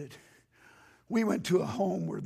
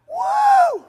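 high, loud whoop; imitated whoop; 18 dB louder than usual. Pastor imitating Kurt's joyful whoop, very high and loud. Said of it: "and Kurt went like this".

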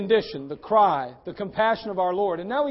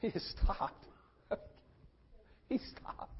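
very soft, breath-only repetition; awed hush; hushed amazement; 12 dB quieter, slower than usual. Real, not acted. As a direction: let it sink in, almost whispered. Preacher marvels at Jesus stopping.

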